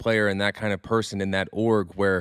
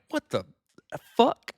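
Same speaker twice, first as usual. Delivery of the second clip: shocked outburst; 13 semitones higher than usual. Retold or imitated. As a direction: pitch jumps way up on fuck. Shocked by injury list notification.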